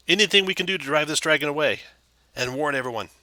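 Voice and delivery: anxious little man pleading from behind a door; nervous townmaster. Acted. GM voices Harbin the townmaster.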